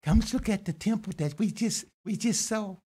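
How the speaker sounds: high, eager voice of the disciples; disciple's voice. Acted. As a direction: point out the temple like an awed follower. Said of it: "So the disciple says".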